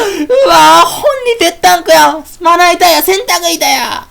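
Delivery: high, loud teasing shout; loud mocking jeer. Fairies jeering at Sakuya's chest, loud mocking shout. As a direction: jeer at her for all to hear.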